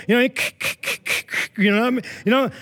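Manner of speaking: ordinary talk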